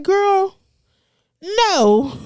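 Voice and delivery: put-on high, loud "Girl, no" shutting her down; flat refusal. To a stranger pushing her baby on him, a high, loud refusal. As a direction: turn her down hard and fast.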